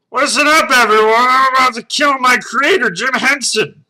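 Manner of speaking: very loud, high-pitched monster shout; yelling. Acted. Red Cookie Monster announcing murder to everyone, yelling. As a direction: bellow it high as a crazed puppet. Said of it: "Then he yelled".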